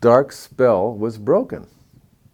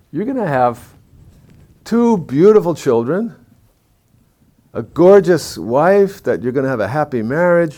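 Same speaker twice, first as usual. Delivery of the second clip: thrilled, much higher and louder, gushing predictions; excited fortune-telling; 8 semitones higher, 6 dB louder than usual. Retold or imitated. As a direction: burst with joy over the palm you're reading. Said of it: "he gets really excited about it".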